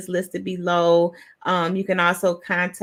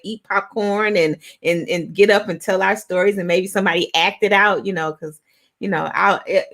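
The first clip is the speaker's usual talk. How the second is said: ordinary delivery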